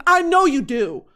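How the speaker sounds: ordinary talk, nothing marked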